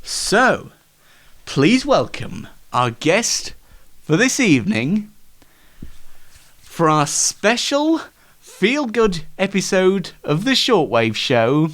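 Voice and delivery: bright showman host intro; chat show host. Acted. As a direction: welcome the guest like a TV host. Host introduces guest in sketch.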